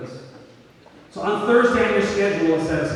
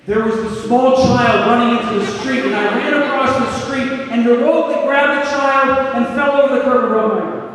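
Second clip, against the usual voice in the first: boastful hero story; 6 semitones higher, 6 dB louder than usual. Retold or imitated. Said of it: "you're suddenly saying".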